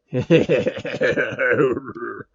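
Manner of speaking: a deep, distant monstrous laugh; deep monster laugh. Acted. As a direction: laugh like something transformed. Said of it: "A deep voice laughs".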